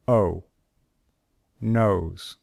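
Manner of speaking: teacher modelling diphthongs clearly; model diphthong drill. Shown as a demonstration. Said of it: "they have another sound".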